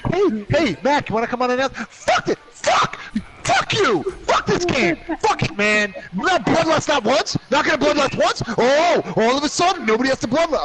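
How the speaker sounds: high-pitched, exasperated mock rant; imitating Jess. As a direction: rant at the game in a squealing impression. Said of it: "listening to Jess be like".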